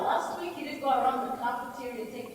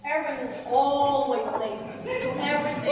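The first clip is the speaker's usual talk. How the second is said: ordinary delivery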